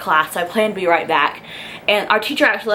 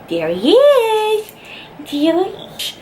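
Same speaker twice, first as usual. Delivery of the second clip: much higher, slow, sing-song There he is; cooing at the puppy; 12 semitones higher, much slower than usual. Real, not acted. Greeting her dog Magnum, high cooing baby talk.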